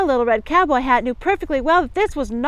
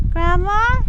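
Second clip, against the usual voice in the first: ordinary delivery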